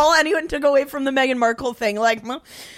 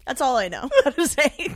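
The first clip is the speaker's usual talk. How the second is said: normal talking voice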